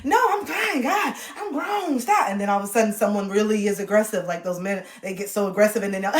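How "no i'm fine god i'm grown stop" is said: put-on high, loud annoyed teen; whiny defiant teenager; teen brushing off. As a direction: brush off mom sharply. Teen snapping at mom.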